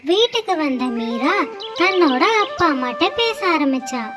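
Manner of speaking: plain reading voice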